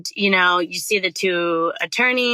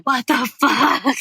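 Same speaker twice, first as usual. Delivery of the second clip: a slow, laughing what-the-hell, pitched up; stunned disbelief; 5 semitones higher than usual. Retold or imitated. To the judge who handpicked her, laughing disbelief. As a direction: caught off guard and half laughing. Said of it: "And I was like".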